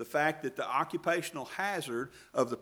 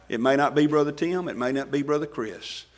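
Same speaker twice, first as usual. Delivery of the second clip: ordinary delivery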